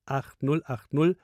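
Nummer deutlich und langsam; langsam diktiert. Real, not acted. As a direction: jede Ziffer einzeln, bedächtig. Ansager diktiert Telefonnummer. (langsam zum Mitschreiben)

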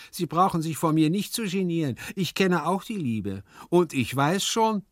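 high, insistent servant voice; sullen servant figure. A narrator's reading. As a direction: answer back knowingly, high-pitched. Hyazinth voiced in the story. (vorlauter Diener, hohe Stimme)

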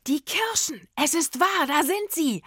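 high, jubilant cries of discovery; excited yell. A narrator's reading. Rufus spots rows of laden cherry trees, excited yell. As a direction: shout it high, phrase after phrase. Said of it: "schrie Rufus".

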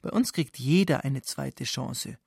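ordinary talk, nothing marked

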